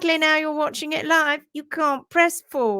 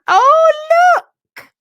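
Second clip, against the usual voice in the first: very high loud squeal of surprise; delighted gasp; surprised oh; 12 dB louder than usual. Real, not acted. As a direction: burst out at the picture. Sudden delight at photo.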